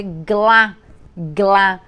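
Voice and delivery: glá said slowly and clearly, the L sounded; demo syllable. Shown as a demonstration. Teacher modelling the syllable glá for pupils, clear. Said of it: "até a minha pronúncia é diferente".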